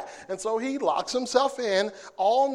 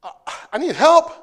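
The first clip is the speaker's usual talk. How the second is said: needing help; 4 semitones higher, 8 dB louder than usual. Retold or imitated. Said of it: "she says".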